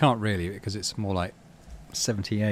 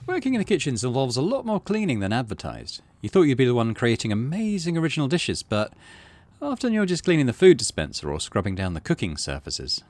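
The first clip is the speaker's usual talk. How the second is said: lively, slightly louder read-aloud narration; game text read aloud; 3 semitones higher, 8 dB louder than usual. Acted. Streamer reading the game's kitchen story text aloud to viewers, lively narration.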